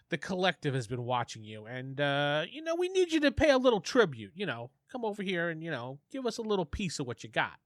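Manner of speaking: put-on mobster accent, leaning on the threat; a lightly done Italian mobster accent, demanding tribute. Gang enforcer shaking down a newcomer, in a light Italian mobster accent. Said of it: "you got me talking like that".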